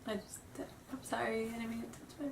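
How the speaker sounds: ordinary delivery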